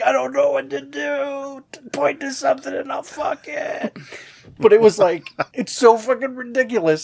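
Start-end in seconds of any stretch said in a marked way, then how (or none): 0.0-3.9 high, yelled desperation; desperate mock shout. MacGruber yelling his offer at the officer, high and desperate. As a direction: beg at full volume, hammed up. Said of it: "he's just like".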